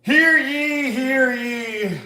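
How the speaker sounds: loud, very high herald's cry; jester crier. Acted. Jester calling to a Renaissance fair crowd, loud high proclamation. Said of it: "I always think of a jester".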